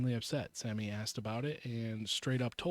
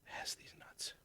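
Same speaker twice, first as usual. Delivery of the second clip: unvoiced hushed whisper; 16 dB quieter than usual. Real, not acted. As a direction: whisper it aside. Whispered aside.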